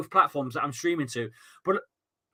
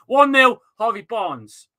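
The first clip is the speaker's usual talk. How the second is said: loud, high burst on "One nil"; excited goal call; 5 semitones higher, 7 dB louder, slower than usual. Real, not acted. Streamer to chat, announcing the goal he missed, loud and high. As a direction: blurt the score out like it just sank in.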